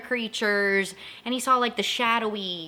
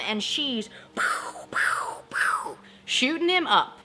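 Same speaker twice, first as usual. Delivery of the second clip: whispered gunshots; 5 semitones higher, slower than usual. Retold or imitated. Mimicking Nancy's gunshots.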